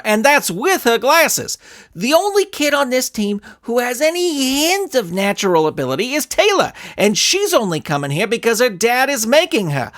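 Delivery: the coach griping about his players; exasperated coach. Acted. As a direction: list each kid's faults in disbelief.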